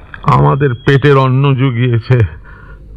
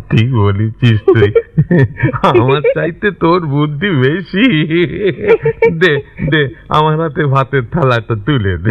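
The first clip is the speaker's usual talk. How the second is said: laughing between the phrases; amused, relieved laughter through the words; delighted chuckling; 2 semitones higher than usual. Acted. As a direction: agree happily, chuckles breaking in. Delighted by daughter's suggestion.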